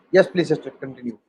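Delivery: ordinary talk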